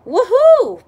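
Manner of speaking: high, loud whoop; loud excited woohoo; joyful shout. Acted. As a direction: whoop like you're on a bumpy ride. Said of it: "Pete shouts".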